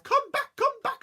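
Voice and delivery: very high, loud shriek. Acted. Wife chasing with her rolling pin, very high loud shriek. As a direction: screech after him. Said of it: "followed by the farmer herself".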